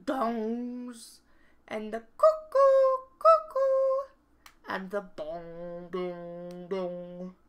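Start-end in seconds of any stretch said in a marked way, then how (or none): 1.7-4.1 high, loud bird cuckoo; cuckoo call. Acted. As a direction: sing out the cuckoo like the clock bird. Narrator imitates cuckoo clock.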